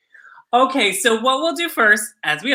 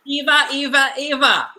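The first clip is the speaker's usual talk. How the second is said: high, playful mock Australian accent; 6 semitones higher than usual. Host showing off their Australian accent, playful. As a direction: put on a higher, hammy Australian accent. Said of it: "That's my Australian accent forever".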